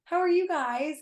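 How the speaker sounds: high, chirpy greeting; light mock of a cheery marketer; mock upbeat host. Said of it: "being like".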